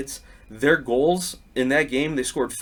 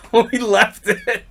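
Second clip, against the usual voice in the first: laughing through the line; real laughter; 9 semitones higher, 5 dB louder than usual. Real, not acted. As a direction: chuckle as you finish. Laughing at the end.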